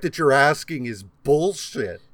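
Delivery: loud, high, indignant hearing testimony; mock an outraged witness under questioning; Brett Kavanaugh. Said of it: "It's like Brett Kavanaugh's hearings".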